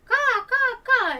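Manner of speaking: loud, very high hawk screech caws; loud hawk cry imitation. Hawk flies out at him, loud high screeching caws. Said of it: "and he goes".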